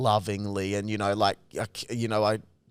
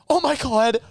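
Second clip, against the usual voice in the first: high, shocked mock exclamation; outraged guy; 11 semitones higher, 4 dB louder than usual. Angry guy scolding the brother, high and shocked. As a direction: gasp it out way up high. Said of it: "He goes".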